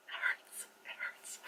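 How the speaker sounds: barely voiced, hurting whisper; pained whisper. Moviegoer retelling heartache at the film, pained whisper. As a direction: whisper it as if wounded.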